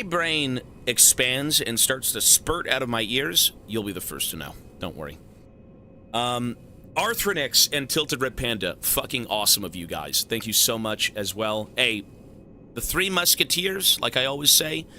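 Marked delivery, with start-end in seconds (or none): none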